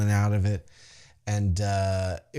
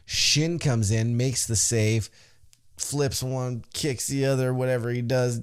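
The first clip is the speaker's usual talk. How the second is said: usual speaking voice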